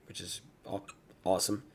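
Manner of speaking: interrupted by a hiccup; word broken by hiccups. Real, not acted. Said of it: "I got the hiccups".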